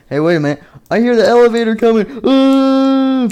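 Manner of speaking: high, loud panicked warning; alarmed cry. Acted. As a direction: cry out in rising alarm, ending in a high wail. Chica raises alarm in story.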